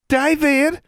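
shouting his name across the hall; calling out. A narrator's reading. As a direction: call David loudly from down the hall. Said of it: "called out a familiar voice".

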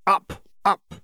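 high coaxing "up, up" to an animal; coaxing call. Acted. Judge coaxing her Jarvie onto her arm, high call. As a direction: coax the creature up onto your arm, high and bright.

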